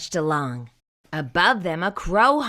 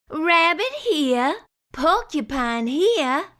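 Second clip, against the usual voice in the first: a beaming hostess; high happy moose wife voice; Missus Moose; 8 semitones higher than usual. A narrator's reading.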